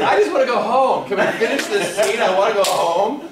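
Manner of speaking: high, loud, pleading whine; exasperated plea. Retold or imitated. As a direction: plead to be let off, half laughing.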